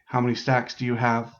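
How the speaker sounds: ordinary talk, nothing marked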